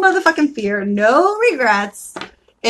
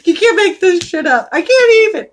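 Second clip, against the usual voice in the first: excited disbelief; 8 semitones higher, 6 dB louder than usual. Real, not acted.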